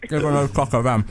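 talking with mouth full; words round a bite of food. Real, not acted. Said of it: "Sorry for talking with my mouth full".

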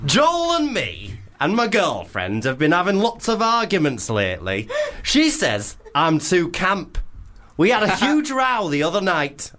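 hammed-up camp northern Leeds voice; camp Leeds accent. Host reads Tim's dilemma in a camp Leeds accent. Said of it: "should we do a Leeds accent".

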